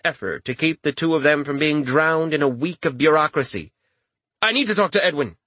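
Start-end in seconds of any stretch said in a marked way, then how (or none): 4.4-5.4 high, urgent blurt. A narrator's reading. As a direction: blurt it out in panic. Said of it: "he blurted".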